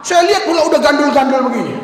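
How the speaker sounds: normal talking voice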